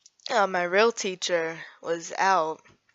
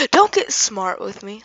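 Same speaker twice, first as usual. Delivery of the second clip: loud mock telling-off; acted-out stern outburst; angry scolding; 4 semitones higher, 8 dB louder than usual. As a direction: imitate a sub snapping loudly at a kid. Voices the sub scolding him.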